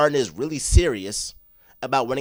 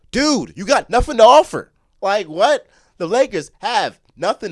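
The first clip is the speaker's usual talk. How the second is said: coughing; 8 semitones higher, 8 dB louder than usual. Real, not acted. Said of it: "I'm coughing and all that".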